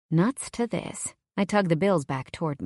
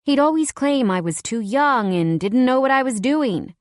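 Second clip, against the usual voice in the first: ordinary delivery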